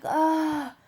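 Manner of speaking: high, whimpering groan from a queasy patient; sick girl's groan. Retold or imitated. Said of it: "she was like".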